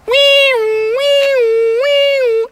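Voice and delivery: squeaky siren wees; playful alarm sound; high car alarm wee. Retold or imitated. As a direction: mimic a far-off alarm in a high voice.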